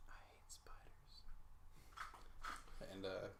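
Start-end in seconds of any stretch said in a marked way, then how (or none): 0.1-1.2 slow, very soft in-character whisper. Acted. Player's character leans toward the spider to cast, slow whisper. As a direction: lean in and whisper it slowly, all breath. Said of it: "lean forward and whisper".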